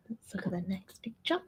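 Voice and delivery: half-whispered aside to co-host. Real, not acted. Host moving on to the next picture, whispered aside. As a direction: drop to a soft whisper, as if to yourself.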